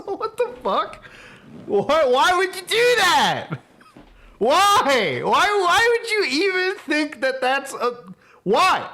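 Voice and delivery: high, slow, loud incredulous cry after a laugh; laughing disbelief. Real, not acted. Streamer asking spendthrift YouTubers why, high, slow incredulous outrage. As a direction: stretch each "why" out in amazed outrage.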